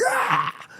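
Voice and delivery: sudden high mock war cry from a fan; fans' mock battle scream. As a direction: throw in a quick hammed-up stadium yell. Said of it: "screaming and like".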